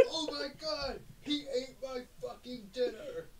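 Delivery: fake-cry over a stolen dinner; mock anime sobbing. Parody anime line with fake crying.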